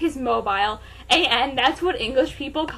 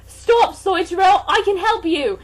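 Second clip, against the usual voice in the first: higher, louder plea; urgent shout; 8 semitones higher, 8 dB louder than usual. Acted. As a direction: shout to stop him. L pleading during gunfight.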